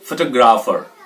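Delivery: Photographer with a weak "fuh" start, "fuh-TOG-raafer"; photographer reduced at the start, falling at the end; stress off FO. Shown as a demonstration. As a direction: keep फो light in photographer. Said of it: "next में भी ऐसे रहेगा".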